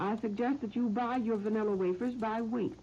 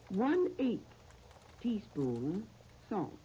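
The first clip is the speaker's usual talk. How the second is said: slow, measured phrases with long pauses; recipe dictation; slower than usual. Real, not acted. Radio host reading out a recipe to listeners, slow dictation. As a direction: dictate it piece by piece, leaving time to write.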